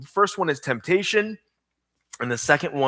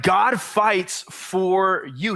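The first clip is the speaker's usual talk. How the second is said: slow emphatic repeat; 4 semitones higher, much slower than usual. Shown as a demonstration. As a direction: land the big idea. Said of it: "Write this down".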